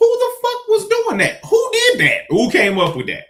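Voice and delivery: high, loud demanding who did it; demanding to know who came up with the saying, loud and indignant; indignant outburst. Real, not acted.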